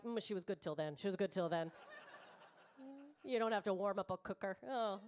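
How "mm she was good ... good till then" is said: low, soft disapproving mutter; mock the men's silent verdict, lower and quieter; a hint of a judgmental man; men's judging look. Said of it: "looked at me now like".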